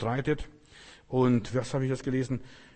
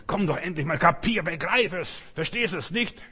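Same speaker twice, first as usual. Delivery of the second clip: pushy pestering; 6 semitones higher than usual. Retold or imitated. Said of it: "Gott wird niemand belästigen".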